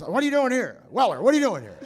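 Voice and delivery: loud, high, incredulous; loud Christopher Lloyd amazement; Lloyd impression. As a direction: demand it like an excited eccentric. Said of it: "He says".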